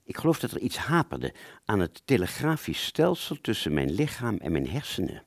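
ordinary delivery, nothing marked

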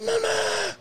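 high, fast, strained cry for mama; muffled desperate wail for mama; strained yell. Retold or imitated. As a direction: try to yell face down on the pavement. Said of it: "you trying to yell, but you can't yell".

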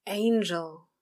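begging the name out; pleading. Acted. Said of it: "he pleaded".

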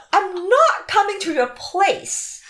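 emphatic refusal. Retold or imitated. Retelling her refusal to his invitation, emphatic and loud. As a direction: plant your feet and refuse. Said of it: "I'm like".

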